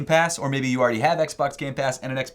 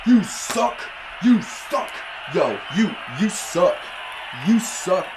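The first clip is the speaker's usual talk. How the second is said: taunting shout; 6 semitones higher, much slower than usual. Real, not acted. Taunting opponent in game.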